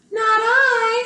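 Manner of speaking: squeaky high-pitched refusal; high cat voice. Acted. Cat turning down the hen in a high voice.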